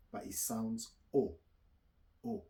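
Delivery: O said loud and rounded, then again; French O sound, repeated. Shown as a demonstration. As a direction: model the French O sound twice. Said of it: "That's how you spell it".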